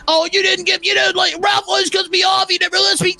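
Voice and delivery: high, shouted mocking whine; mocking whiny Dingo. Host mocking a guest's complaint, high shouted whine. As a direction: sneer it like a whining complainer. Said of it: "He wants to talk about".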